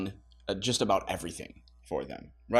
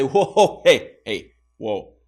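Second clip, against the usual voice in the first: stern parent; 7 dB louder, slower than usual. Pretend. Parent halting a blaming teenager with a loud stern rebuke. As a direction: stop the kid right there, sharply. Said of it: "No, you're gonna say".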